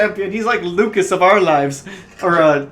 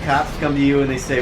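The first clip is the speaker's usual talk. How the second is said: ordinary talk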